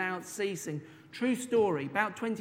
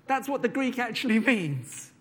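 normal talking voice

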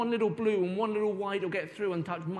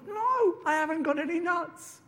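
higher, fed-up teacher; 9 semitones higher than usual. Acted. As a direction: brush the squirrel off again. Teacher refuses again.

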